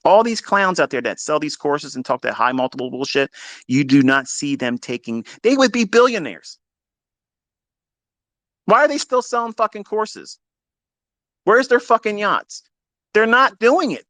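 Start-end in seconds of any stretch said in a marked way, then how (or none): 5.4-14.0 heated rant. Real, not acted. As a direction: hammer the point home, scoffing at course sellers. Ranting at course sellers.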